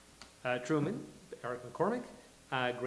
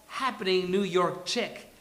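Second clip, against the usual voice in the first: loud, high, punchy billing; big projected narrator delivery; announcer-style introduction; 4 semitones higher, 9 dB louder than usual. A narrator's reading. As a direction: hype the character like an announcer. Narrator introduces Grace with gusto.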